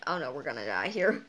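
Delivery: doomed astronaut. Pretend. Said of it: "and like".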